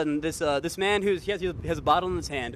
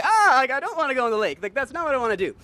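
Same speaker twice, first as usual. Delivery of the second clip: pleading protest; 8 semitones higher, 6 dB louder than usual. Retold or imitated. Pleading while being lifted up.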